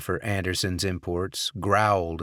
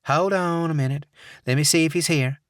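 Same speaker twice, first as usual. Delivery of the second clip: sugary Southern receptionist; Southern accent; 9 semitones higher, 4 dB louder than usual. Acted. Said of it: "saccharine Southern accent".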